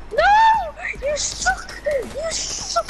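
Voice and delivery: high yelling no, you suck. Real, not acted. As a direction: yell it, frustrated. Reacting loudly to death.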